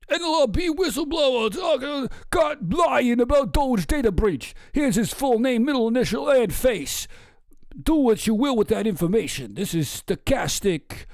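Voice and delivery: lampoon the poster's smugness; mock tweet voice. Reading the tweet in voice.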